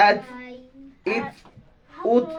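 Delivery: আত, ইত, উত said singly with a puff on t; aspirated final t. Shown as a demonstration. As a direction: let air escape after each of আত, ইত, উত. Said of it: "একটা বাতাস বের হচ্ছে না".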